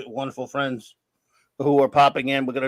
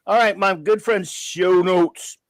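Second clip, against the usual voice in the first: put-on light Cajun accent, a bit higher; 3 semitones higher, 4 dB louder, slower than usual. Host announcing show notes from the bayou in light Cajun. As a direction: slip into a Cajun lilt from down in the bayou. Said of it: "I'm not gonna do it in this kind of accent".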